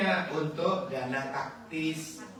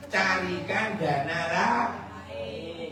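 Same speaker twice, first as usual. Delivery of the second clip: nasihat pelan; 4 semitones higher, 4 dB louder, much slower than usual. Real, not acted. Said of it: "nasihat tegas diulang".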